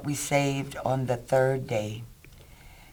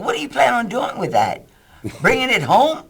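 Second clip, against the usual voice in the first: higher, louder mock panic; nervous Doug; 8 semitones higher, 6 dB louder than usual. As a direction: imitate him flustered and incredulous. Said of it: "nervous like he is".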